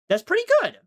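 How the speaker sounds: "That's pretty good" jumped way up high; high-pitched repeat. Pretend. Repeats line, second time pitched up.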